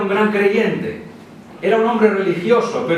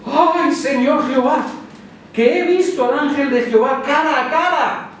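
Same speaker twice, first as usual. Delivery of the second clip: higher, alarmed cry of awe; Gideon's dread; 7 semitones higher than usual. Acted. Gideon realises he saw God, higher frightened exclaim. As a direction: gasp it out like a frightened farmer.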